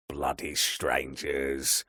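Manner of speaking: put-on slow, grumbling old codger; grumpy old man. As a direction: grumble it like a tired old watchman.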